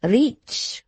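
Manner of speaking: put-on French accent. A narrator's reading. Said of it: "remarked the foreign gentleman".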